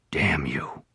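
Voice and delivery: unvoiced, bitter whisper; cornered man cursing his captor in a whisper; whispered curse. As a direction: hiss the curse under the breath. Noah cornered, spits curse.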